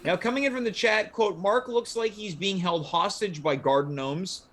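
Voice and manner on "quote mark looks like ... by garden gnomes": ordinary delivery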